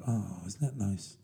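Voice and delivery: soft, slow, gentle coo; cooing approval. Retold or imitated. Mocking the kind Bake Off crowd, soft and slow. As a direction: murmur it sweetly, like cosy onlookers. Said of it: "And everyone's like".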